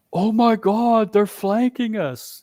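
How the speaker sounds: high mock British cry of alarm; imitated British accent, high and drawn out. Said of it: "in his British accent".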